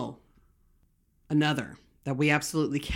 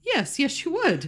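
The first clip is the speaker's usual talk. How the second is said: teasing squeak; 10 semitones higher than usual. Real, not acted. Teasing high-pitched retort.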